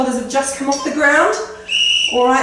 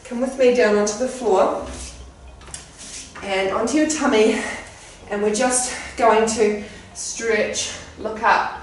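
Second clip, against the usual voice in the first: soft, calm cool-down voice; calm stretch cues; 2 semitones lower, 4 dB quieter than usual. Acted. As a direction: ease them into the stretch.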